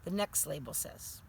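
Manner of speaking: plain reading voice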